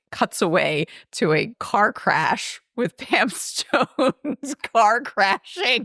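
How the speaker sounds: helpless giggly retelling, pitch climbing; talking through laughter. Real, not acted. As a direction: crack up as you tell it. Cracking up while retelling absurd scene.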